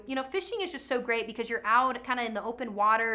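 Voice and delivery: ordinary talk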